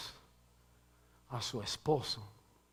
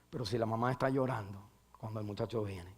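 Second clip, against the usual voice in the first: ordinary talk, nothing marked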